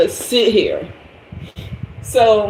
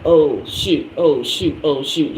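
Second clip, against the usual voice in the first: lower, dropping worried oh shoot; mock alarmed man; 6 semitones lower than usual. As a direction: play him fretting lower and lower. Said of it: "So he's like".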